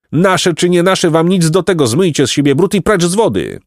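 high, insistent telling-off; indignant scolding. A narrator's reading. Beaver orders muddy boys to wash and leave, angry scolding.

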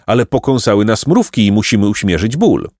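ordinary delivery, nothing marked

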